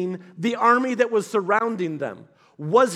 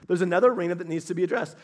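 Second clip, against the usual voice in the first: quick restatement to drive it home; fast repeat; 3 semitones lower, much faster than usual. Real, not acted. Preacher repeating his point quickly to the room, rushed. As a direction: rattle it off fast.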